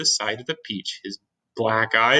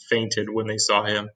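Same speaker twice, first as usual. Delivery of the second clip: plain reading voice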